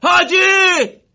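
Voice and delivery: very high, very loud drawn-out cry; desperate scream. Acted. Morteza screams for the fallen Haji with all his might. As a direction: scream the name with everything you have. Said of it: "با تمام وجود داد زد".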